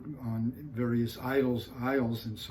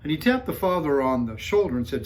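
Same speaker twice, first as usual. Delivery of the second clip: ordinary talk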